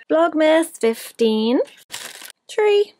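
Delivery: high, loud and slow; excited announcement. Real, not acted. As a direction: sing it out like a title card. Announcing vlog day excitedly.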